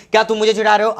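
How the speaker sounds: usual speaking voice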